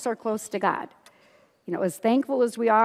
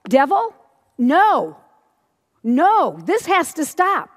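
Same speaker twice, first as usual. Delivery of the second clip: loud, higher, commanding refusal; forceful rebuke; 6 semitones higher, 6 dB louder, slower than usual. Retold or imitated. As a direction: order the devil out, punching each "no". Woman rebuking the devil, retold.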